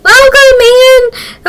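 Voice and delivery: high, drawn-out singsong greeting; mock-grand welcome held long and high; playful welcome.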